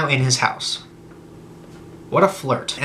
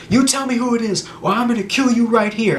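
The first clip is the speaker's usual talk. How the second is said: higher-pitched menacing demand, not shouted; mock threat; 7 semitones higher than usual. Upset man holding a broken bottle to her, mock threat. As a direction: act out an upset man's ultimatum.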